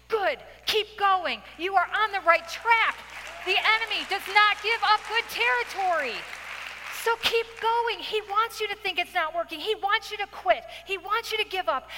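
high-pitched, emphatic preaching; rousing exhortation. Real, not acted. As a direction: urge them on with rising fervour. Exhorting the women to persevere.

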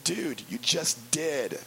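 slow dry retort. Retold or imitated. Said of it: "I'm thinking".

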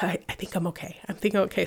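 barely voiced, still in shock; shaken, quiet. Checking herself after fall.

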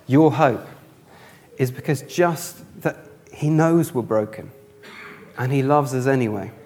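usual speaking voice

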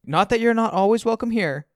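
very high, loud mother voice; hasty reassuring mom. A narrator's reading. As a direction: add it anxiously to reassure. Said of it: "Then she adds quickly".

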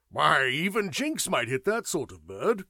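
loud, gleeful teasing cry; hilarious cry. A narrator's reading. Sir Howard teasing his host Jinx, loud and hilarious. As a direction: crow it at your host's expense. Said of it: "cried Sir Howard, hilariously".

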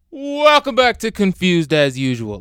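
loud, high, slow hammed-up show intro; psycho podcast host. Waking the family at five with a crazed intro. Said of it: "I'd sound like a fucking psycho".

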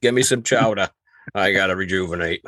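hammed-up Maine local accent; playful thick Maine accent; Down East Maine accent. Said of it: "the down east Maine".

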